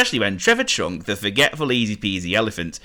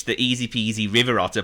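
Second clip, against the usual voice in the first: ordinary delivery, nothing marked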